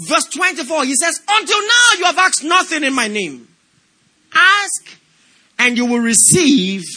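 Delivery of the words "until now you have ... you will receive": loud, emphatic scripture reading; preaching. Acted. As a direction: proclaim it to the church.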